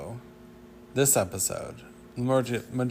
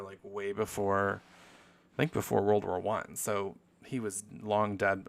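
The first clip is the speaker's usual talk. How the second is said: normal talking voice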